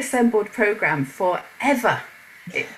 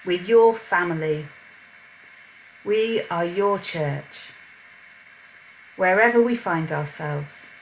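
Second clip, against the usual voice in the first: spoken prayer. Acted. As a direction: pray aloud to God for the gathered people. Host leads an opening prayer.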